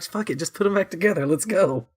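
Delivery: high, offhand alien voice; careless alien. Retold or imitated. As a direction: brush it off like a lazy alien. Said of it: "They're like".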